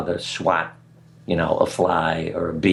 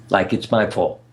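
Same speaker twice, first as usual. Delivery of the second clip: ordinary talk, nothing marked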